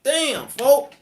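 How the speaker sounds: high, loud happy outburst; Damn, folk thrown up high and loud; excited Damn. Retold or imitated. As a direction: let the joy burst out. Getting happy at release news.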